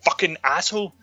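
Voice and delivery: shrill exasperated rant, high; high-pitched rant. Real, not acted. Ranting at Franklin on screen.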